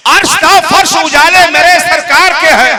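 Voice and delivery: loud, lifted naat line; verse recitation. Acted. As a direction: declaim it over the crowd.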